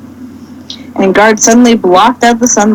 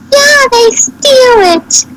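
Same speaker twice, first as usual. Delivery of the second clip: much higher, slow, put-on guard voice; complaining fat guard; slower than usual. A narrator's reading. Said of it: "fat guard complained".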